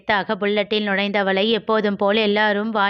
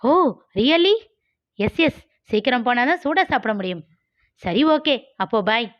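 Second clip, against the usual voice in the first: put-on teasing girl Malar; 3 semitones higher than usual. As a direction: needle him like a cocky young woman. Malar delighted.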